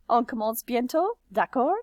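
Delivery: high, loud rise on d'accord; tag question intonation. Shown as a demonstration. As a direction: lift the voice sharply on d'accord. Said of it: "end a statement with a tag question".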